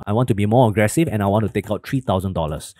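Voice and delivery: usual speaking voice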